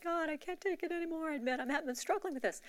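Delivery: mild retold crying. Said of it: "after that moment on the bench when I kinda cried".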